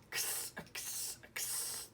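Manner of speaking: X whispered three times as ks; x sound. Shown as a demonstration. Teacher gives a mystery bag hint to pupils, whispered ks. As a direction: hiss ks, ks, ks. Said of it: "It starts with a X sound".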